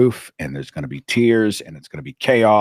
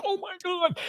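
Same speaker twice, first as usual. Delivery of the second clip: much higher, quiet stunned gasp; inner shock; 9 dB quieter than usual. Retold or imitated. Narrator learning cousin reads his book, high stunned. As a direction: gasp in disbelief. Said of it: "I'm like".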